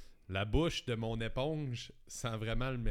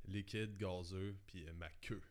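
slow punchline; 4 semitones lower, 8 dB quieter, slower than usual. Acted. Slow joke punchline delivery.

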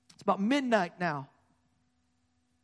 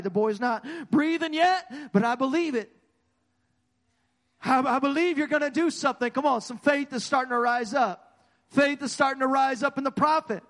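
rising preaching fervor; 3 semitones higher, 4 dB louder than usual. Acted. Preacher's faith building up.

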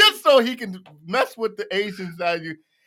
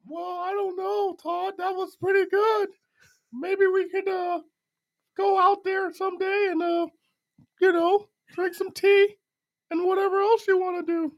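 Paul Bearer; 12 semitones higher than usual. Pretend. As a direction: stretch it out high like an eerie ringside manager. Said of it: "What do you think, Undertaker?".

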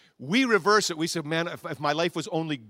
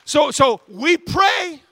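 raised preaching; 12 semitones higher, 11 dB louder, slower than usual. Real, not acted. Preacher after the chant.

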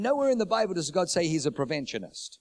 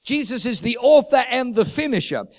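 preaching at full force; 5 dB louder than usual. Acted. Preaching climax.

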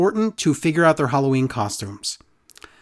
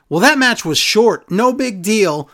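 high, dismissive mock commentator; 5 semitones higher, 3 dB louder, slower than usual. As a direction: brush it off like a smug announcer. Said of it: "Now they say".

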